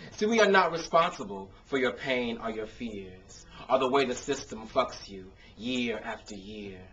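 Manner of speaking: rhythmic performed poetry with punchy builds; spoken word poem. Acted. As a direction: perform it like a slam poem on an open mic stage.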